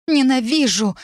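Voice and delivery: high-pitched outburst of hatred; high, angry exclamation; furious cry. A narrator's reading. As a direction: cry it out as if hurling something. Throws crystal in a fit of rage.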